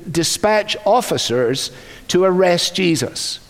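ordinary talk, nothing marked

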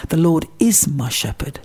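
"is" punched out firmly; stress on is. Shown as a demonstration. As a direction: hit the word "is" as a settled fact. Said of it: "is emphasised".

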